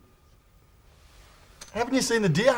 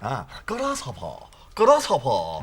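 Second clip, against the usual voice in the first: mock old master drawing out the word; grasshopper bloke impression; 9 semitones lower, slower than usual. Mates mimicking the bloke from a TV ad, mock master voice. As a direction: do a put-on kung-fu master, words stretched. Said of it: "that grasshopper bloke".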